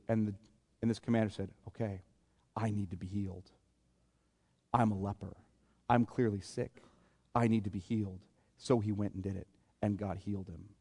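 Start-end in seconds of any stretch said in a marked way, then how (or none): 1.7-8.2 low, very soft, resigned confession; hushed and humble, in short separate phrases; humbled admission. Acted. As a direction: give in quietly, admitting you need help. Acted commander humbly admits his need.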